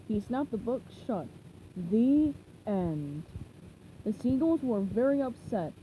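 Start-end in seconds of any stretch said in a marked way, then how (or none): 1.8-3.2 low smug pirate; lightly voiced pirate. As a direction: shut it down with a low drawl. Pirate closing the book.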